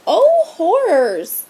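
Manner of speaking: high, slow gasp of horror; shocked teacher crying out; horrified exclaim. A narrator's reading. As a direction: clutch your pearls in dismay. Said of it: "exclaimed Miss Irma Birnbaum".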